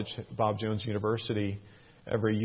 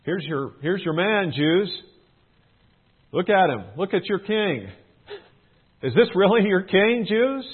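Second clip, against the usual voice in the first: higher, louder sneering taunt; mocking taunt; 8 semitones higher, 5 dB louder than usual. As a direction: jeer at them with a scornful lilt.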